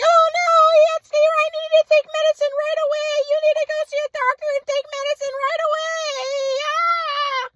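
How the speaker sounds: mock her fussing in a squeaky voice; high caricature mom urging medicine, ending in a shriek; frantic mom. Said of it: "And my mom was like".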